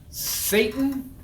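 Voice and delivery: high, proclaimed summons; mock-solemn invocation. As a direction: call out to address an unseen enemy. Demonstrates solemn address to Satan.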